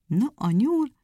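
ordinary delivery

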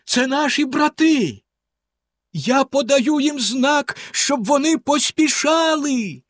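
high, drawn-out excited calling; slow, high-pitched cries of hope; exclaiming. A narrator's reading. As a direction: shout the hopeful news from the tower. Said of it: "вигукнула вона за мить".

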